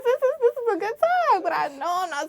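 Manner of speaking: high, giggly; laughing. Real, not acted. Confessing guilty pleasure, high laughing voice. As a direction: laugh while admitting it.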